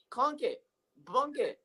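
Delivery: usual speaking voice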